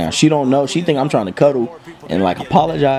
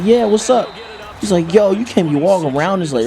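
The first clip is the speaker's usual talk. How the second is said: lightly voiced friend; 7 semitones higher than usual. Man Man voiced high.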